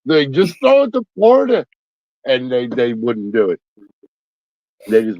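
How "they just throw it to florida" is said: high mock yell at the screen. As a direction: yell it like a fed-up fan. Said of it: "screaming at the T V".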